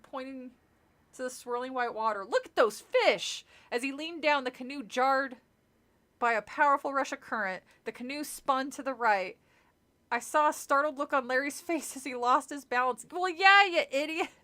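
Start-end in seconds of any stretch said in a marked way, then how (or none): 2.2-3.4 excited call. Acted. Counselor excitedly pointing at fish. 13.1-14.3 loud, high jeering shout; scolding a foolish character out loud; exasperated yell. Real, not acted. Reader yells at falling character.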